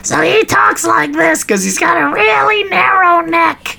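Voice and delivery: very high, thin pigeon voice. Shown as a demonstration. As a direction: talk through a narrow pigeon neck. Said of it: "That's my pigeon voice".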